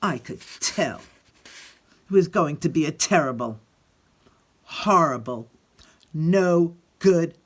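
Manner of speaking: put-on lively read-aloud voice for children; expressive picture-book reading; story narration. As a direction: tell it like Alexander grumbling through his day.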